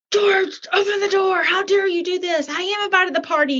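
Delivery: high, strained cry at the door; plead, mad and frustrated, with George. Retold or imitated. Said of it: "you get so mad and you're frustrated".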